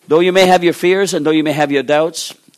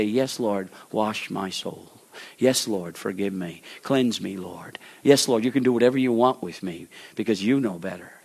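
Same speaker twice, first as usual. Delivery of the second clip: humble prayer; 4 semitones lower, 7 dB quieter than usual. Acted. Believer humbly surrendering to the Lord, soft prayer. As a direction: pray it gently in surrender.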